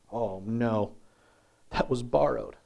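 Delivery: low, soft, sinking "oh no"; acted dismay. Student losing a borrowed axe head, quiet dismay. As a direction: voice quiet, understated dismay as it sinks in.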